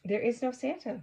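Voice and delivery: a light mock-insistent kid; insisting friend. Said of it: "my friend had insisted".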